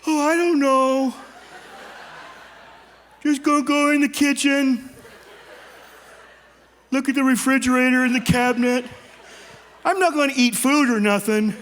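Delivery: lightly voiced guilty little boy; a hint of a caught four-year-old. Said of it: "I turn into a four-year-old boy".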